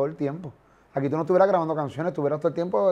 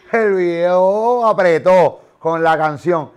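very loud, drawn-out hype shout; booming, emphatic praise; excited hype; 3 semitones higher, 11 dB louder, much slower than usual. Retold or imitated. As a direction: belt it out slowly, stretching the words. Hyping the music video.

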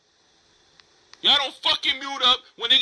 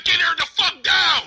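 retold shout, slightly slowed; mock shouted demand; 3 semitones higher, slower than usual. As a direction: imitate the chat yelling about her.

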